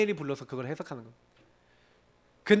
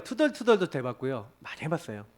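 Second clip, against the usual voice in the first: ordinary talk, nothing marked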